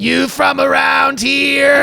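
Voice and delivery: slow drawn-out catcall shout. Pretend. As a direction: holler it at a passing girl. Bugs catcalling like boys.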